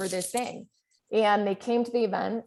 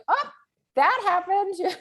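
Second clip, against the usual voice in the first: mock breezy; 11 semitones higher than usual. Mock casual reaction to F.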